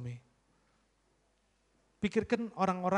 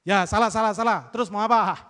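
loud, curt admission thrown back; defiant forced apology; 3 semitones higher, 9 dB louder than usual. Retold or imitated. Preacher mocks a spouse's grudging apology, loud and defiant. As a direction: spit out the apology like a challenge. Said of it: "kalau saya ngomongnya begini".

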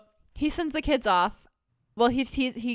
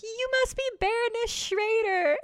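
high, bright-eyed mock shout of greeting; excited yell; 14 semitones higher than usual. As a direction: blurt it out high and eager like an overjoyed governess. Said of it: "like, yelling".

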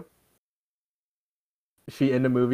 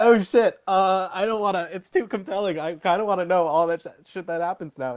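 mock dismay; 7 semitones higher than usual. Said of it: "I was like".

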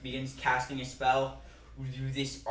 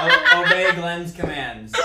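slow, raised, commanding voice; loud command; 4 semitones higher, 11 dB louder, slower than usual. Acted. Wizard orders his skeleton to obey Glenn, loud and drawn out. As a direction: call the order out over the laughter. Repeats the command over table's uproar.

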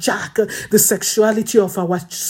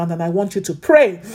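ordinary talk

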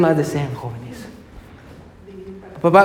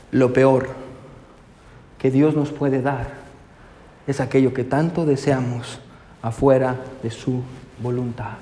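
hushed preaching; 6 semitones lower, 6 dB quieter than usual. Acted. Preacher quietly drives home the main truth, hushed. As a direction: lean in and speak it softly. Slow hushed sermon climax.

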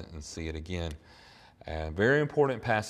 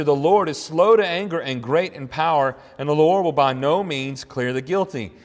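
louder, higher preacher's reading; emphatic scripture preaching; 6 semitones higher, 9 dB louder than usual. Real, not acted. Preacher reading the prophet's words to the congregation, emphatic proclamation. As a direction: proclaim the verse with force.

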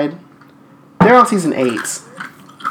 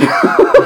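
high, loud cackle of laughter; burst of laughter; 5 dB louder than usual. Real, not acted. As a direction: crack up suddenly. Laughing at tired cohost.

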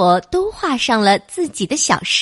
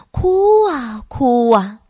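drawn-out; 4 dB louder, slower than usual. A narrator's reading. Narrator draws out the crying.

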